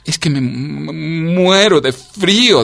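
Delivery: high, long-drawn stammer; poor frozen creature, slow and high; freezing shiver. A narrator's reading. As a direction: stretch the words with a cold shudder. Cicada says it is freezing.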